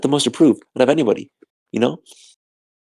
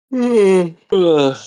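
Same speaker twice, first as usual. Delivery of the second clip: long sigh; 8 semitones higher, 5 dB louder than usual. Real, not acted. Exhausted streamer sighing.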